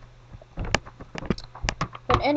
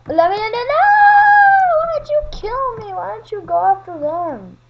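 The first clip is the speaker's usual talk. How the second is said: much higher, louder frantic scream; panicked scream; 10 semitones higher, 7 dB louder than usual. Real, not acted. Kid being chased and killed, screaming in panic. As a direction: scream as if running for your life.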